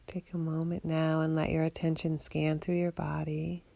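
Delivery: ordinary delivery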